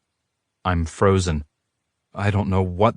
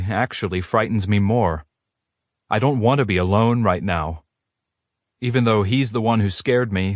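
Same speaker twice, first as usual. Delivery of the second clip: ordinary delivery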